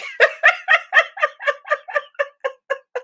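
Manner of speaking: real delighted high laughter; high burst of laughter. Real, not acted. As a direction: crack up at the memory. Laughing at psychiatric joke.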